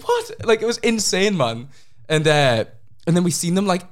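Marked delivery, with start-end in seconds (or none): 0.0-0.4 squeaky high burst of disbelief; shocked what. Retold or imitated. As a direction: jump way up in pitch, stunned. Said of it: "we were like".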